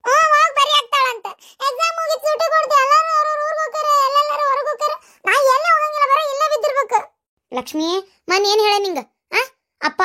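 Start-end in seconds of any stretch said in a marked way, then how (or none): 0.1-7.0 high, tearful complaint; whining protest. Acted. Sister grumbling that everyone else gets holidays, whining. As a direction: complain in a high sulky whine.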